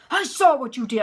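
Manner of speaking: loud, high furious snap; angry accusation. Retold or imitated. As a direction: catch her red-handed, furious. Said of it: "he came after her just like this".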